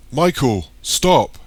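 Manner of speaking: loud, high-pitched command; shout. A narrator's reading. Eric chasing Michael, shouting for him to stop. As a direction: yell it down the street. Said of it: "shouts Eric".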